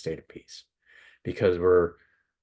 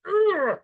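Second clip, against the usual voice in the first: exasperated groan; 4 dB louder than usual. Retold or imitated. Exasperated at brother's driving.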